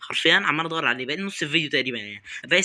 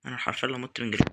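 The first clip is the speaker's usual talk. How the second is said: ordinary talk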